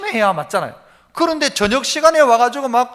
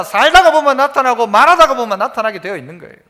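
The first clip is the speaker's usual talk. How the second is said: ordinary delivery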